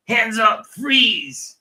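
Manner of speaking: high, loud American movie cop; American cop accent. Shown as a demonstration. American cop accent shouted at robbers. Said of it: "my American accent's the same".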